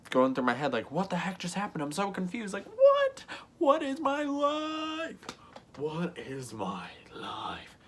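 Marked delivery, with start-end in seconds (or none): 0.9-5.2 climbing into a very high squeal; hammed-up confusion, rising to a squeaky wail; mock bewildered panic. Retold bewildered inner panic. 5.8-7.8 slow, drawn-out mock-despair whisper; bewildered whisper. Same line repeated, stunned.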